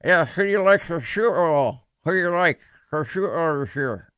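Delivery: garbled words through a wide-open mouth at the dentist; mouth-open dentist-chair mumble. Retold or imitated. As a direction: talk as if the drill is in your mouth. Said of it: "I'm, like, talking like this".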